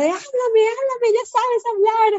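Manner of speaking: high, loud exasperated snap; shrill frustrated order to speak; exasperated mom. Retold or imitated. Mom losing patience with her daughter, high exasperated snap. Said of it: "Y yo de".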